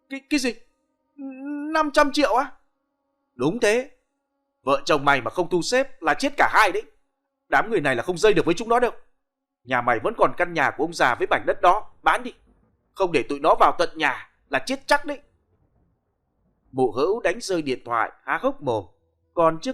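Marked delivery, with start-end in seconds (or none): 0.1-2.5 very high stammered disbelief; stammering in shock. Acted. Wife stammering in shock at five hundred million. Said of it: "mụ lắp bắp". 3.3-15.2 urgent warning. Acted. Nam warning her urgently.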